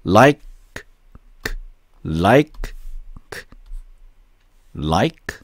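"like" repeated with pauses, each k a bare release; no added vowel. Shown as a demonstration. Teacher drills the word for learners, bare k on "like". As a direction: end each "like" on a voiceless k, no 으. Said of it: "이런 식으로".

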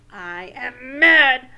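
loud, slow mock-mad declaration; acted anger. Shown as a demonstration. Teacher recapping rule two, acted anger. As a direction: hammer the feeling in pretend anger. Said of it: "name our feelings".

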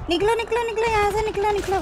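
high, hurried alarm call; urgent warning. Real, not acted. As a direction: get your team out right now. Warning team to get out.

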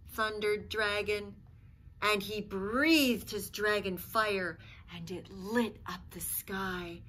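put-on expressive read-aloud for children; lively picture-book reading voice; storybook narration. A narrator's reading. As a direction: tell it like a bedtime story.

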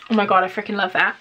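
ordinary talk, nothing marked

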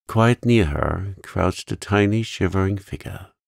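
ordinary delivery, nothing marked

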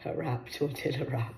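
normal talking voice

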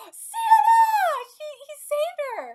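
thrilled scream. Real, not acted. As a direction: scream the name, overjoyed at the rescue. Cheering a rescue in the story.

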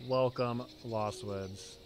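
ordinary talk

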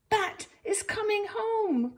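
high, loud joyful shout; excited cry. A narrator's reading. Bob calling out the news that Bat returns, high and loud. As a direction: burst out with the happy news. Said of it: "cried Bob".

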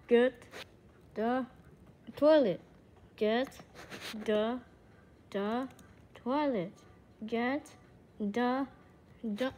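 one word, then a pause. Pretend. Player ordering get the toilet, word by word. Spaced-out command to the game.

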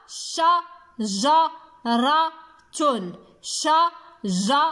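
شجرة slowly as sha, ja, ra, tun; four separate beats on each شجرة; split into syllables. Shown as a demonstration. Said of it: "أين سمعنا صوت الجيم".

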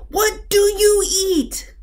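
high, slow, stretched-out frog question; frog shout. A narrator's reading. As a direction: call it out high, dragging each word. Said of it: "shouted the frog".

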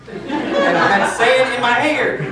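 slow, loud, laughing sing-song; amused sing-song groan about sand; laughing punchline. Real, not acted. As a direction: drag it out while laughing. Comic story punchline, sand.

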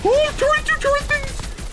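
very high squeal, coaxing his turret; high-pitched mock plea to the turret; squeaky falsetto cheer.

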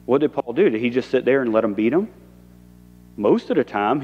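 ordinary delivery

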